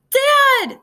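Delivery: very high, loud shout. A narrator's reading. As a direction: cry out desperately for her father. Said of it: "Vanessa shouted".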